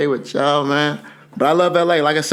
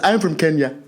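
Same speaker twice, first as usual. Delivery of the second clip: put-on African accent; 8 semitones higher than usual. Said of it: "my accent come out".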